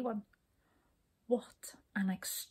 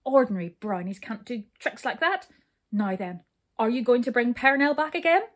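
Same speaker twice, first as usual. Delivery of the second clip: loud, cross demand; storming; 2 semitones higher, 8 dB louder than usual. A narrator's reading. King demanding that brownies return Perenelle, storming. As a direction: press them angrily, building louder and quicker. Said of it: "stormed the king".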